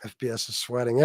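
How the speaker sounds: normal talking voice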